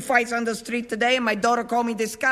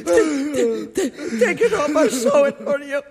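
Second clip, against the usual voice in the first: higher, stammering sob-laugh; laughing through tears; 4 semitones higher than usual. Acted. Widow laughs through tears offering to sew the jacket. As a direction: giggle and weep at once.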